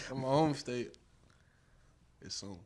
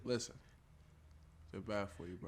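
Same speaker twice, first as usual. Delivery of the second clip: mock solemn warning; 23 dB quieter than usual. Mock serious warning to camera.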